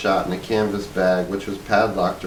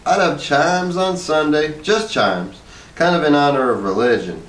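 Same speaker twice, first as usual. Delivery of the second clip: Hazel's voice; 6 semitones higher than usual. A narrator's reading. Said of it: "said Hazel".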